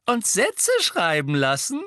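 high, loud mocking question; smirking call. A narrator's reading. George piling on Hermine's threat, smirking. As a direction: tease her through a smirk. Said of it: "rief George feixend".

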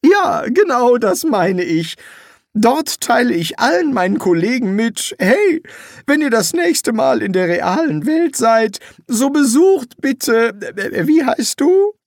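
high, loud eager promise; eager wizard. Acted. Rincewind promises to praise the summoner, eager and loud. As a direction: oversell the favour.

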